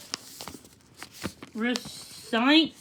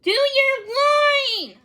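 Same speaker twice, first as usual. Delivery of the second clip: much higher, louder, slow insistent cry; urgent shout; 13 semitones higher, 6 dB louder, much slower than usual. A narrator's reading. Sammy prodding Charles to recite, loud slow plea. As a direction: demand he speak up now.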